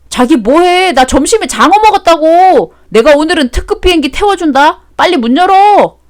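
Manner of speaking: a tipsy man showing off through the door; loud boastful drunk voice; drunken bragging. Acted.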